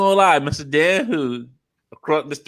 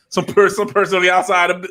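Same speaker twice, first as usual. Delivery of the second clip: normal talking voice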